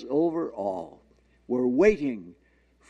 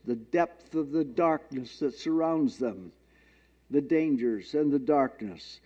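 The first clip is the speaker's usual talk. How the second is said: ordinary delivery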